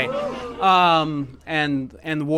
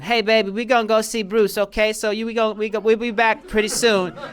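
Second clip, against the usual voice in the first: higher, louder imitation of mom; mom impression; 7 semitones higher, 6 dB louder than usual. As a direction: playfully mimic mom calling her baby. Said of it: "I'm trying to do my mom's impression".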